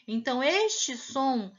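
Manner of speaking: ordinary delivery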